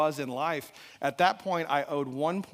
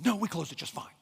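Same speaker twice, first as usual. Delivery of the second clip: higher-pitched irritated comeback; retold testy reply; snappy retort; 4 semitones higher than usual. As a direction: snap defensively at your wife. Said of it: "I'm snapping at her".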